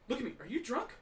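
ordinary talk

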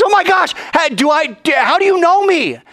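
high, loud disbelieving cry; astonished. Acted. Nathanael asking Jesus in shocked disbelief. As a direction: gasp out how he knows.

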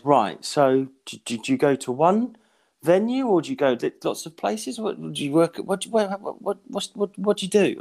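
high, stumbling mock interviewer; puzzled caller imitation. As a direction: fumble through the questions as a confused caller. Plays the puzzled tracing caller.

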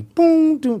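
loud high boom falling to doom; sing-song train chime; train door chime. Retold or imitated. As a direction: imitate the subway's two-note chime. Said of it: "the sound of the train".

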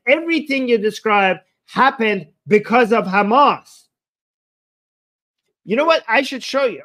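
angry retort. Real, not acted. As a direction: snap back at him in disgust. Heated debate insult on stream.

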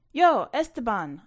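loud call across the yard; loud friendly hail from a distance. Acted. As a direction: shout his name to get his attention. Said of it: "I called when he came into sight".

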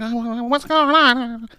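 put-on very high, slow, fluttery bewildered old woman; high dithering granny voice; nervous old lady. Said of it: "she looked like she was nervous".

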